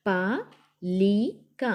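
"పలిక" very slow, split as pa, li, ka; letter by letter. Shown as a demonstration. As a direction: sound out "పలిక" with long gaps between letters. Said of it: "ఒత్తి పట్టి చదవాలి".

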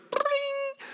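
high sung bring like a ringing phone; phone ring imitation. Telling kids the phone rang, high ring sound.

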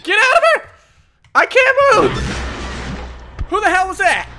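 high terrified yelling; shrieking in real fright; panicked scream. Real, not acted.

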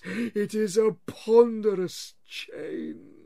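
high, slow, loud moaning spectre; wailing ghost. Acted. Marley's ghost wails at Scrooge, slow and loud. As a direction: drag each word out like a wail from the grave.

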